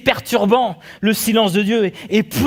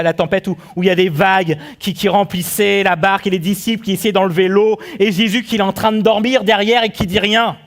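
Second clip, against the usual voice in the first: usual speaking voice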